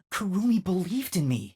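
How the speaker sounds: normal talking voice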